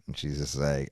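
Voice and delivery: normal talking voice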